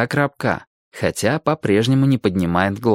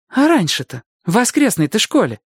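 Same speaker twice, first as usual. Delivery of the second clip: put-on girl's voice; 11 semitones higher than usual. Girl voiced in dialogue.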